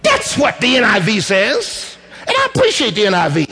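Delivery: high, rising preacher's build; preaching at full force. Acted. Preacher telling the church Jesus was angry, rising preaching. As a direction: climb in pitch, pressing the point home.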